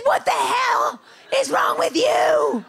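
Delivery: high, loud, slow shout of disbelief; outraged yell. Retold or imitated. Bitten roommate yelling at her, outraged high shout. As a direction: yell at her, shocked and hurt.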